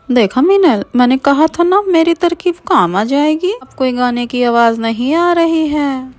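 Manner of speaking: put-on high, pleased girlish voice; smug friend. Rinki bragging to Meenal about her trick, high and smug. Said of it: "Meenal से कहती है".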